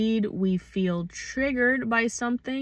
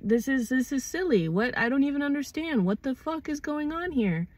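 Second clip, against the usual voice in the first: high-pitched, incredulous confusion; bewildered protest; 6 semitones higher than usual. Acted. Acting out a person accused of being a goose, bewildered. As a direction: climb higher as you protest in disbelief.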